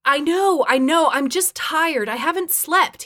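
high, loud, defensive excuse; defensive, pitched high; excusing himself. A narrator's reading. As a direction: offer a hurried-sounding, pleading excuse. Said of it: "like it was a reasonable excuse".

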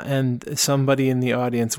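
ordinary delivery

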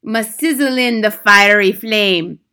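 put-on slow, loud accented curse. A narrator's reading. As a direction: chant the threat with relish on each word. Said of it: "The Grand High Witch went on".

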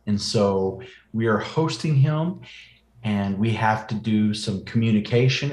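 ordinary talk, nothing marked

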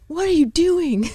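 high-pitched mock yell; imitated scream. Wife imitating husband catching her daydreaming, mock scream. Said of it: "He's screaming at me".

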